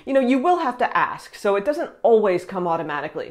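normal talking voice

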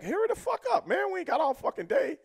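high-pitched retold nagging; impatient hurrying. Veterans rushing the rookie with the bags, high impatient scolding. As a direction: hustle him along, pitched well up.

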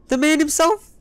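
high, rising hail to a friend; excited greeting. Real, not acted. Spotting Benny, he hails him with a high excited call. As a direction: cheer as the trading partner appears.

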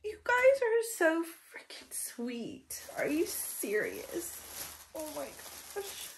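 real tearful whisper, barely voiced; too moved to speak aloud; choked whisper. Real, not acted. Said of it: "I can't even read the rest of the card".

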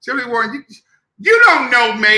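high, loud indignant retort; defiant shout. Acted. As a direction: yell it back indignantly. Mock defiant drunk retort.

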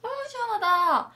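high, relieved pet voice; voicing contented cat.

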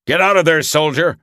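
loud urgent command. A narrator's reading. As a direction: order the soldier out fast. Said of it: "Ross commanded".

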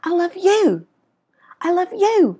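"you" high and loud, falling at the end; stress on YOU. Shown as a demonstration. Teacher singling out the one loved, stress on YOU. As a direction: save the big lift for "you". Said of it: "mình muốn nhấn mạnh vào từ anh".

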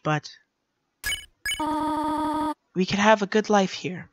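soft, slow motherly plea; gentle goat mother. Acted. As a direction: coax the child softly to go upstairs. Voices Toriel, Undertale goat mother.

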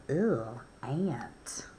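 a hushed "ugh, ant" breathed out in real disgust; disgusted whisper. Real, not acted. Teacher spotting the ant card, disgusted whisper. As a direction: shrink back and whisper at the bug. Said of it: "that scares me a little bit".

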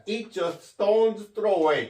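slow, careful English model phrase; slow model reading. Shown as a demonstration. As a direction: read it out slowly for learners. Teacher models English phrase slowly. (천천히 또박또박 읽는 예문)

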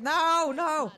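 high, loud wailed refusal; insistent protest. Real, not acted. Player refusing a trade from teammate, insistent protest. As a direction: cry out in real protest.

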